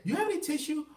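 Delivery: woman asking sweetly. Retold or imitated. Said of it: "And she said".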